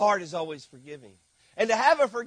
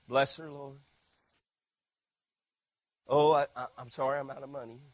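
soft, lower, sugary-holy inner voice, fast at the end; mock pious saint; 6 semitones lower, 7 dB quieter, faster than usual. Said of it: "what do you think I ... was thinking".

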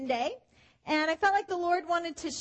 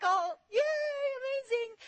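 mock delight; 7 semitones higher, slower than usual. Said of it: "I'm like".

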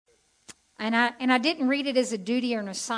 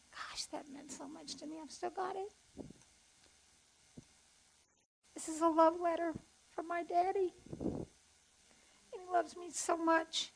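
higher and softer, voice catching with real tears; holding back tears; 7 semitones higher, 8 dB quieter than usual. Real, not acted. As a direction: fight back the tears as you speak of your father's love. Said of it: "I'm trying not to cry".

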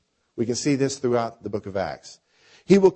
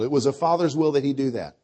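ordinary delivery

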